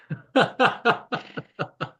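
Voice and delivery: helpless high laugh after losing it; real burst of laughter. Real, not acted. Host cracking up at the accent joke, laughing hard. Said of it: "that made me laugh so hard".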